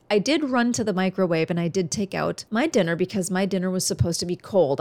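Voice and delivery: ordinary delivery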